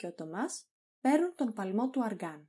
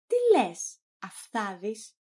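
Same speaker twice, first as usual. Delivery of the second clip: acted indignation, pitched up sharply; indignant outburst; 5 dB louder, slower than usual. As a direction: burst out, affronted at the cheek. Master reacts to maid's cheek.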